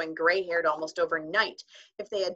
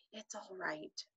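much softer whisper; 15 dB quieter, slower than usual. A narrator's reading. Omri to the tiny frightened figures, a soft whisper. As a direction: hush them gently, almost no voice. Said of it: "he whispered".

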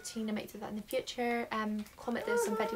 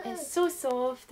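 gushing; 3 semitones higher, 6 dB louder, much slower than usual. Real, not acted. Gushing over a soft toy.

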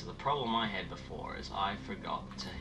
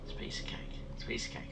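unvoiced whispered self-reassurance; whispering; 7 dB quieter, faster than usual. Real, not acted. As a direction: whisper it to calm down. Calming himself, whispered.

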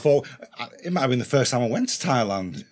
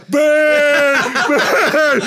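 loud, high mocking chant; crowd mocking; 11 semitones higher, 6 dB louder than usual. Crowd mocking the English guy after the round, loud high mocking chant. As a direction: taunt like a crowd rubbing it in. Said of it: "they go".

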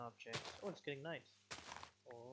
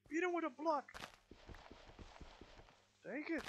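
excited battle cry; 12 semitones higher than usual. Real, not acted. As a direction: cheer him on at the top of your voice.

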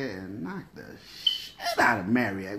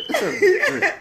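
squeaky high movie-character voice; high-pitched character voice; 8 dB louder, faster than usual. Retold or imitated. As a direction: pitch it way up, laughing. Movie line repeated.

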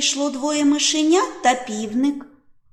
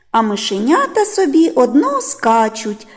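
ordinary delivery, nothing marked

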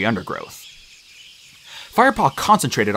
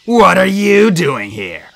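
loud, raised, drawn-out challenge; growled; 7 semitones higher, 6 dB louder, much slower than usual. A narrator's reading. Whitestorm confronts Firepaw on the patrol's trail, loud growl. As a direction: growl the question slowly and forcefully. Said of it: "growled Whitestorm".

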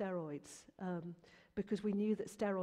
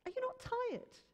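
much higher, puzzled question; incredulous mum. Retold or imitated. Mum collecting her son from school trip, incredulous. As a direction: pitch it way up, checking in disbelief. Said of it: "I said".

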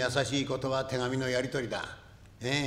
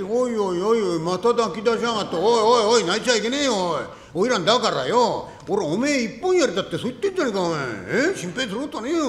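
loud, high, fussing reassurance; loudly calm her tears, half laughing; loud coaxing; 7 semitones higher, 11 dB louder than usual. Acted. 泣く女を大声でなだめる. (泣く花魁を大声でなだめる男)

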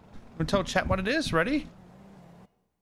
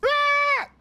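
sudden high, loud yelp of surprise; startled gamer yelp; high shocked yell; 5 dB louder than usual. Real, not acted. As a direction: cry out at the game. Game shock.

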